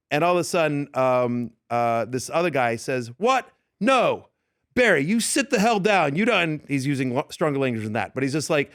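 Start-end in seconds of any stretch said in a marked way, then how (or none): 3.2-6.4 higher-pitched, indignant order to sit down; acting out a guy yelling someone back into his seat; mock outraged yell. Retelling a club showdown, mock angry yell. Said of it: "so now they're yelling back and forth".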